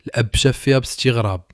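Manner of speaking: ordinary delivery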